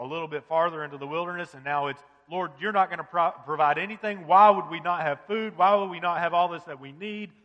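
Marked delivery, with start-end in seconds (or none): 2.3-7.3 grumbling accusation. Retold or imitated. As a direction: voice the people's angry grumbling at God, louder. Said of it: "That's what they're saying".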